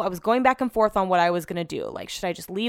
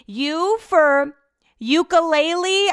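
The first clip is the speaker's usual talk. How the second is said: high, bright imitation of the receptionist spelling; chirpy phone agent; 9 semitones higher, 5 dB louder, much slower than usual. Receptionist spelling the street name to her, chirpy high voice. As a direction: mimic the agent's cheery U for ukulele.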